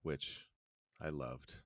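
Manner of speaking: low, soft and subdued; mournful. Acted. Ash mourns his loved truck, low and soft. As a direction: mourn the truck under your breath.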